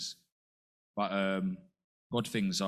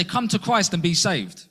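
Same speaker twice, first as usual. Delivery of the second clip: higher and louder, a mock street call; preachy slogan; 7 semitones higher, 8 dB louder than usual. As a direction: proclaim it like a stock slogan. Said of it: "Or we say".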